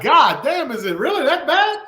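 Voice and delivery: retold shock, breaking into a laugh; mock disbelief. Husband recalling what his future wife said, amused disbelief.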